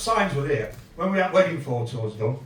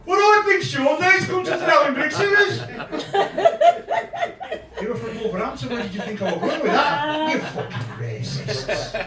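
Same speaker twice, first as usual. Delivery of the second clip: Indian accent impression; 10 semitones higher, 4 dB louder than usual. Student Rajiv scolding the scallies, in an Indian accent. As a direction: do his mate's Indian accent with a bit of indignation. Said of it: "I'm gonna try and do his accent".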